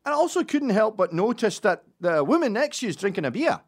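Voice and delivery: high, loud Scottish busybody; Scottish accent. Acted. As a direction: keep prying as a nosy Scotsman. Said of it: "I kept it Scottish".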